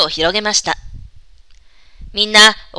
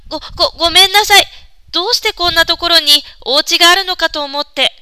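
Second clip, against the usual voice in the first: higher, fast, stammering apology; timid and fearful; 7 semitones higher than usual. Acted. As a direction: nervously stutter out an apology. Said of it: "恐る恐る答えました".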